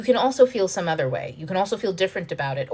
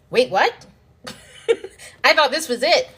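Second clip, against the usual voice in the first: higher, louder astonished "wait, what?" with a laugh; surprised laugh; 7 semitones higher, 4 dB louder than usual. Pretend. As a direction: react as if the prize just got bigger.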